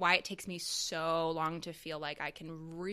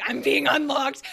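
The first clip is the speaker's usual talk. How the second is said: much louder, higher, gleeful; mock thrill; 7 semitones higher, 13 dB louder than usual. Retelling her thrilled reaction to a friend, loud and gleeful. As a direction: squeal it with delight. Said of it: "and then I was like".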